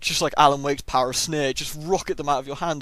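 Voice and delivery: usual speaking voice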